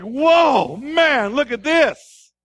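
loud, high amazed shout, slowing at the end; Adam thrilled at the woman God made, loud delight; awestruck excitement. Retold or imitated. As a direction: marvel out loud like a man overwhelmed.

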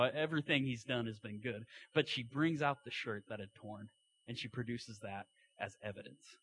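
ordinary delivery

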